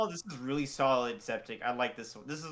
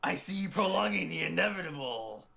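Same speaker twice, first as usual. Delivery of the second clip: lightly hammed-up anime villain, a bit higher; Adam villain voice; 4 semitones higher than usual. Voicing Adam for the next OVA, mock villain. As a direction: play a mock-menacing villain. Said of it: "voice Adam in our next O V A".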